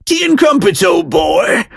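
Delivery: high, hammed-up British accent; mock British. Host swapping donuts for tea and crumpets, mock British accent. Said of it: "if you mention anything about being British".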